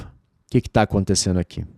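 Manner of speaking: ordinary delivery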